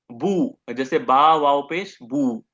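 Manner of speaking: slow बू said with rounded lips; letter sound demo. Shown as a demonstration. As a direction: draw out बू slowly from the front lips. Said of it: "ये जो बू की आवाज है". (धीमे से बू की आवाज़ दिखाना)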